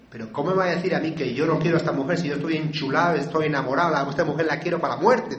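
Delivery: indignant protest. Retold or imitated. Man retelling his retort to his sponsor, loud and indignant. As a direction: protest hotly that your love is real. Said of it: "cuando me dijo eso me enojó".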